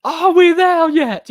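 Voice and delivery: high, loud, slow whiny squeal; drawn-out high nagging wail; whiny Donkey. Retold or imitated. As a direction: whine it up high like a pestering kid. Quoting Donkey's whining line.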